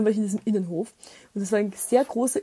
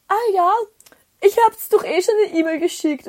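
high, louder mock surprise; mimicking the landlord; 10 semitones higher, 7 dB louder than usual. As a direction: play the caught-out landlord, higher. Imitating the man's reply. (als ertappter Vermieter, höher und lauter)

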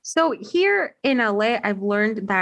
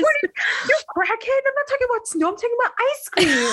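fast, very high, whiny disbelief; incredulous rant; 13 semitones higher, faster than usual. Retold or imitated. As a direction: rant like they've lost it. Mocking own reaction to cousins.

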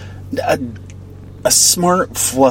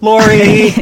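playful sing-song call; 10 semitones higher, 7 dB louder than usual. Real, not acted. Teasing name-calling back and forth.

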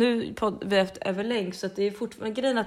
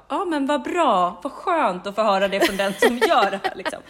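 sarcastic; 4 semitones higher, 7 dB louder than usual. Retold or imitated. The patient mocks the doctor's remark with sarcastic cheer. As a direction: fake cheer to mock the doctor. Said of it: "Jag bara".

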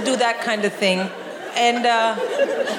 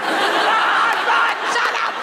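frantic cheering shout; 11 semitones higher, slower than usual. Retold or imitated. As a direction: shout at the screen. Cheering over the narrator.

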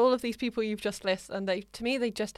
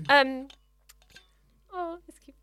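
ordinary talk

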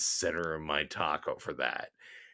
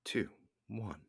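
countdown broken by a hiccup; 6 dB quieter than usual. Real, not acted. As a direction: hiccup on the last word. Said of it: "You said crack through a hiccup".

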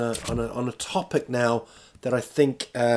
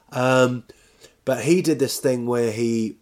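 normal talking voice

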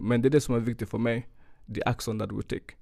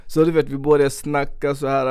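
usual speaking voice

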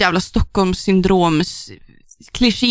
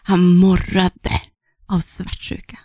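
slow, then whispered; mock romance read; 3 semitones lower, slower than usual. Shown as a demonstration. Reading the romance line in a mock sultry whisper. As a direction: breathe it like a sultry romance novel. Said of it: "porträtteras med att de morrar".

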